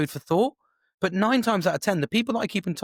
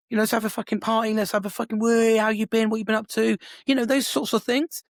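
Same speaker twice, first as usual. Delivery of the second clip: high, quick party-hungry enthusiasm; excited reunion; 6 semitones higher than usual. Acted. Imagining meeting his sister after years apart, excited. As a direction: rattle it out, raised and eager.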